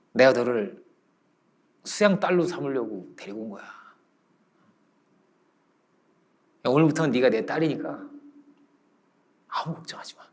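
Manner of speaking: low, soft fatherly comfort; hushed and gentle, soothing a frightened child; tender reassurance. Retold or imitated. Master tenderly adopts the child.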